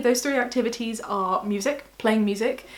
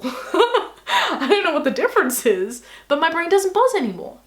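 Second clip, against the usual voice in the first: higher, louder while laughing; 7 semitones higher, 5 dB louder than usual. Real, not acted. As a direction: laugh through the words. Laughing about trifle.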